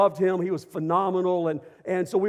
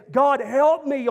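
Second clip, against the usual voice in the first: crying out for help; 6 semitones higher, 5 dB louder, slower than usual. Retold or imitated. Person calling out in service.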